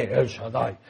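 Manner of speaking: low, mock-grand mystery voice; mysterious. Committee president showing off the name, low and mysterious. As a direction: drop low on the impressive name. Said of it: "Como misterioso y que wow".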